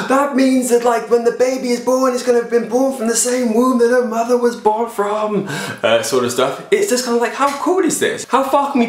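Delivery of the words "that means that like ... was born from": amazed. Pretend. Voices an amazed reaction.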